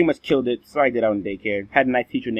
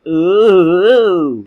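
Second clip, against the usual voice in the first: high, loud mocking ooh; teasing class ooh; 6 semitones higher, 9 dB louder than usual. Classmates teasing the boy over his card, loud sing-song ooh. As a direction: tease like a whole class. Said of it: "everyone in class said".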